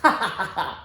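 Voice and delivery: short demonstrated happy laugh. Shown as a demonstration. Reader showing children how the boy laughed, happy laugh. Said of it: "He could laugh".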